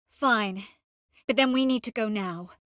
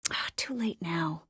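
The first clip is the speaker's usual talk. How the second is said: hushed regret; 4 semitones lower, 6 dB quieter than usual. Acted. Hushed remark at a setback.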